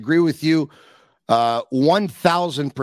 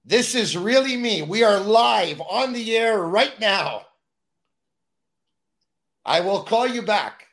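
dodging a caller; 6 semitones higher than usual. Real, not acted. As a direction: firmly cut the caller off. Telling caller they're live.

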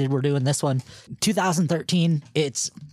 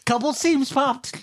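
high strained silly voice; 10 semitones higher, slower than usual. Pretend. Joking about bursting pants.

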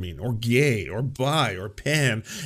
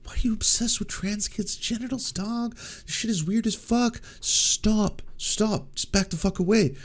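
high-pitched, half-whispered disgust as a coworker; high, breathy imitation of a disgusted coworker; high mock coworker; 9 semitones higher, 3 dB quieter than usual. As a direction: play a grossed-out colleague hissing high. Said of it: "people at work are like".

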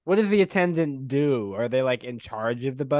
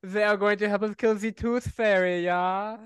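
mimicking Isaac; 7 semitones higher than usual.

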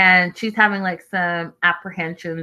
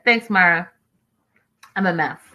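normal talking voice

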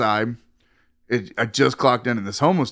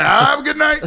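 higher, louder sarcastic send-off; mock dismissive shout; 13 semitones higher, 5 dB louder than usual. Bouncer sends away a woman with an expired ID, with a loud sarcastic shout. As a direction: shout it as a smug brush-off.

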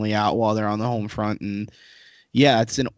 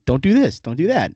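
higher, louder mock warning; mock cautioning; 5 semitones higher, 5 dB louder than usual. Host mimicking naysayers' travel warnings, mock cautioning. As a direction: imitate a nagging word of caution. Quoting people's stupid cautions.

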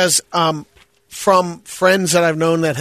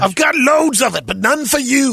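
higher, mock Scottish accent; 9 semitones higher than usual. Shown as a demonstration. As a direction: deliver the line high, with a Scottish lilt. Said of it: "Not do it in a Scottish accent".

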